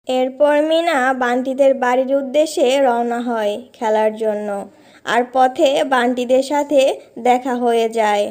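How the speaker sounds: even storyteller's narration voice; story narration. A narrator's reading. Narrator tells children how Meena sets off to play, in storyteller voice. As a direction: narrate the scene calmly for children. Narrator between cartoon dialogue.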